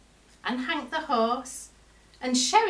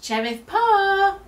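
high, loud call stretched out; shouting; 11 semitones higher, 9 dB louder, slower than usual. A narrator's reading. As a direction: call out to someone far away. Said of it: "he shouted".

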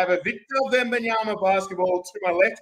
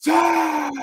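Bruce Buffer impression; 10 semitones higher than usual. Host mimics the ring announcer to open the break, hammed-up shout. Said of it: "my best Bruce Buffer".

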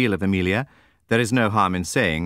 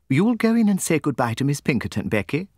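higher, lighter voice for Jemima; Jemima's voice; 8 semitones higher than usual. A narrator's reading. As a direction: lift the voice for Miss Jemima. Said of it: "said Miss Jemima".

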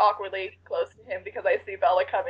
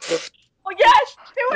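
high, loud squeal of approval; thrilled, soaring cheer; excited yes; 6 dB louder, much slower than usual. Real, not acted. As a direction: burst out with real glee. Delighted reaction to sneaking plan.

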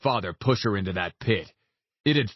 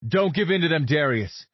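urgent raised call; shouting; 5 semitones higher than usual. Acted. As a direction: call out to pull him back. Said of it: "Lance shouted".

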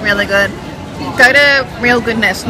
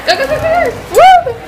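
much higher, rising to a loud whoop; excited cheering; 3 dB louder than usual. Real, not acted. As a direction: cheer them on. Cheering bath bomb into water.

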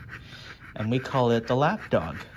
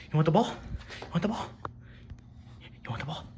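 higher pitched asks, bouncing up and down; playful dog-ball teasing; 6 semitones higher, much faster than usual. Real, not acted. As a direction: tease the dog into chasing.

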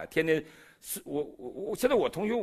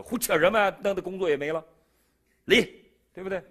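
higher, louder mock scolding; imitating a nagging wife, raised voice; 8 semitones higher, 7 dB louder than usual. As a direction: snap at him like an exasperated wife. Wife scolding him, mock quote.

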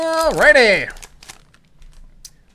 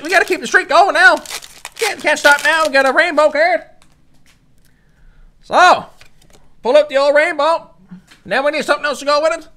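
ordinary talk, nothing marked

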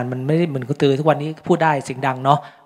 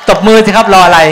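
loud, higher call to the crowd; rally the audience to clap; 4 semitones higher, 10 dB louder than usual. Real, not acted. Host urging the audience to applaud, loud call.

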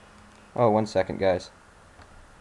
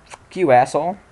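angry outburst; 5 semitones higher, 6 dB louder than usual. Real, not acted. Gamer swearing at opponent.

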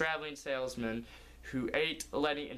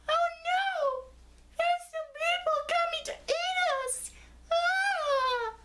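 squeaky high panicked scream, much higher and louder; panicked little cone; 4 dB louder, slower than usual. Acted. Little cone Barrick fleeing the hunters, squeaky panicked shriek. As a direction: shriek in tiny-cartoon terror. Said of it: "Barrick said".

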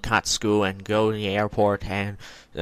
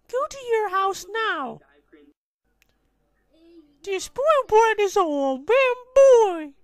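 high character voice; 5 dB louder than usual. Acted. New character scolds the kid.

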